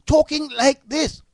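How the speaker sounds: high, loud, emphatic English accent; English accent restored. Shown as a demonstration. Host correcting the slip for listeners, exaggerated English accent. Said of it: "I mean".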